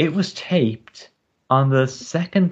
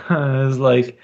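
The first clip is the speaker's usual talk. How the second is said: usual speaking voice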